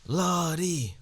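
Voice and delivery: a loud mock-weary Lordy; exasperated lordy. Real, not acted. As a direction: sigh it out to the heavens. Exasperated exclamation.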